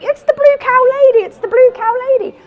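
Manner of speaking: thrilled stranger yelling in recognition; excited shouting. Retold or imitated. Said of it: "running down the road going".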